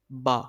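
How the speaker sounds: با with mouth and voice opened wide. Shown as a demonstration. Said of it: "منہ اور آواز کو کھول کر". (منہ کھول کر با)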